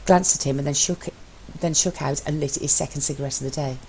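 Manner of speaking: usual speaking voice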